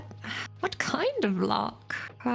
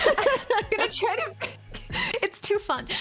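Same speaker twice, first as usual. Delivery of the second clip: laughing; 11 semitones higher than usual. Real, not acted.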